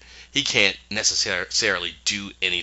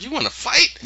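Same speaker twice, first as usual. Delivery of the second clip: mock challenge.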